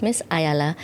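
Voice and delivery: usual speaking voice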